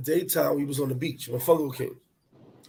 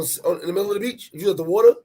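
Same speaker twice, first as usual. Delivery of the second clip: normal talking voice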